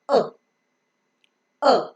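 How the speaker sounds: U said as a short "uh"; a brief, isolated uh for U; short U sound. Shown as a demonstration. Teacher giving learners the phonic sound, short uh. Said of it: "a phonic pronunciation of".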